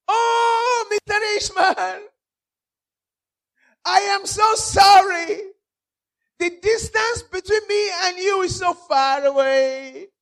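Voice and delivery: high, loud, sustained wailing call; Lazarus answering. Acted. Lazarus tells rich man he is sorry, high drawn-out call. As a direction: call slowly across a vast distance. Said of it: "Then Lazarus answered".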